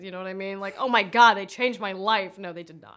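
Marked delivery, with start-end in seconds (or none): 0.8-2.4 loud, hammed-up awe; mock gushing. Actor joking about childhood TV to interviewer, mock gushing. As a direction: gush with mock amazement, then drop it. Mocks shows as life-changing.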